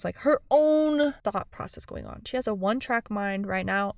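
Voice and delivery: normal talking voice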